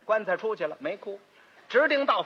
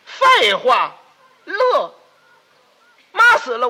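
loud retort; 3 semitones higher, 9 dB louder, much slower than usual. Acted. Snapping back at the neighbour.

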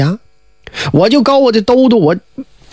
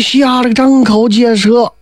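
plain reading voice

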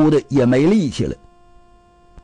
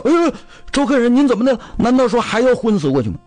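much higher, fast startled cries; alarmed bystanders; 9 semitones higher, faster than usual. Acted. Onlookers cry out as Zhou Jin hits the board, much higher and fast. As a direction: shriek in alarm at his fall.

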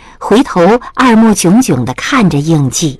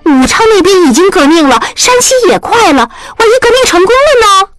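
higher and fast, indignant protest; 8 semitones higher, faster than usual. Acted. Niece indignantly argues with her aunt. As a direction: argue back hotly, almost in tears. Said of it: "依依气愤地说".

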